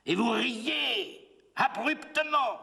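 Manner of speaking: sudden high, ringing cry; declaimed outburst. Acted. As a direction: burst out high, stretching the words. Sudden outburst within poem. (éclat soudain, voix haut perchée)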